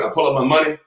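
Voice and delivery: ordinary talk, nothing marked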